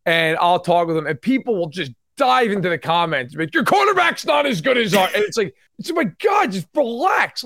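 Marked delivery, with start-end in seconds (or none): 3.5-5.1 shouted high mock jab; hammed-up troll shout; mock angry fan. Mock fan yelling on Twitter. 5.9-7.4 high, fed-up plea; mock exasperated groan. Exasperated reply to fans.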